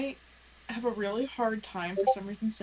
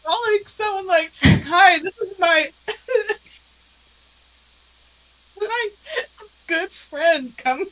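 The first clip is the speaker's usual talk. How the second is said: loud, high and shouted through laughter; howling with laughter at the silly nickname; laughing hard; 8 semitones higher, 15 dB louder than usual. Real, not acted. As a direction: crack up mid-sentence, barely getting words out. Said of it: "Oh, Lord".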